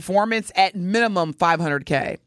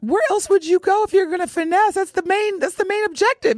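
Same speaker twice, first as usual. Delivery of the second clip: excited; 12 semitones higher than usual. Real, not acted. Teasing Telly about finessing in.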